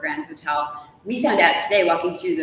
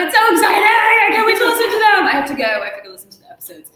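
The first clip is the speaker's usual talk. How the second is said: much higher and louder hammed-up squeal; mock excitement; 6 semitones higher, 10 dB louder, faster than usual. Author fakes fan excitement at her own episodes. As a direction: gush like a fan who can't contain herself.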